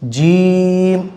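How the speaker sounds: जीम stretched very long; drawn out. Shown as a demonstration. Teacher stretches the letter for students, very slow. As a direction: hold जीम out slowly. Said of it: "इसको भी हम खींच के पढ़ेंगे".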